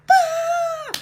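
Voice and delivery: a sudden high, loud mock cry of overwhelm; hammed-up emotional outburst; mock wail. Host tells viewers how she feels each week, mock wail. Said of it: "'cause I'm like".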